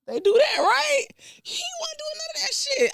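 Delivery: high-pitched indignant rant; incredulous rant. Real, not acted. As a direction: squeal it in disbelief. Ranting about the realtor.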